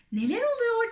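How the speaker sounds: very high, crying question; startled shout. A narrator's reading. Boy leaping from his hammock, startled cry. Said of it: "diye bağırdı James hamağından fırlayıp".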